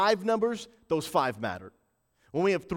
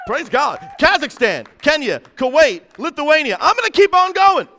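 high, loud preacher's shout; triumphant shouting, pitched high throughout; hyped roll call; 8 semitones higher, 9 dB louder than usual. Real, not acted. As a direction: fire off each name like a rally announcer.

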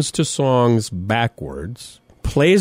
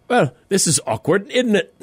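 lightly hammed-up British lilt, pitched up; mock British accent; 6 semitones higher than usual. Host imitating nervous young people on an awkward date, mock British accent. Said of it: "in an accent".

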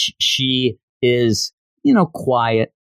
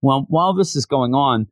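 ordinary delivery

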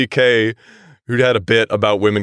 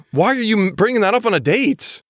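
high, incredulous protest; exasperated disbelief; 8 semitones higher than usual. Real, not acted. Reader protesting at the awkward date question, exasperated. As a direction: object as if it were unthinkable.

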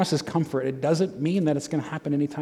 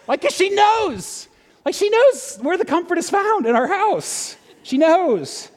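excited; 12 semitones higher than usual. Real, not acted. Excited punchline about daughter.

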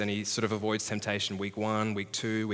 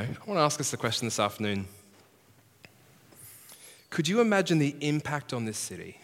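ordinary delivery

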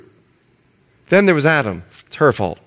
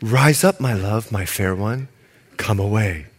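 romantic reading; 9 semitones lower than usual. Shown as a demonstration. Preacher reads the lover's call to the congregation, low and tender. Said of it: "I'm gonna read it like the way it should be".

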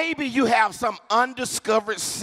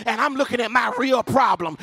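normal talking voice

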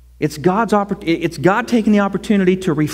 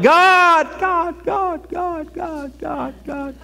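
desperate cry; 9 semitones higher, much slower than usual. Retold or imitated. Desperate prayer cried out in cave.

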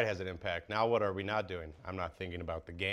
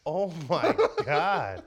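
disbelief; 6 semitones higher, 6 dB louder, much slower than usual. Retold or imitated. Disbelief at proud parents.

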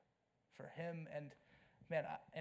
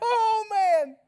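loud, much higher cry of shock; excited outcry; 18 dB louder than usual. Retold or imitated. Preacher mimicking the audience's gut reaction, a loud high outcry. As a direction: belt it out like the movie just wrecked you. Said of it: "And you're like".

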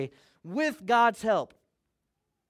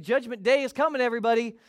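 mock warning call; 5 semitones higher, 6 dB louder than usual. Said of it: "He's saying".

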